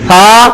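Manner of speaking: "سا" as a long thaa; tongue tip on upper teeth for "سا"; lisped, whistle-free "سا"; soft th. Shown as a demonstration. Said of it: "تینوں حرف ایک مخرج سے نکل رہے ہیں".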